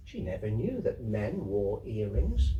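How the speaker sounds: plain reading voice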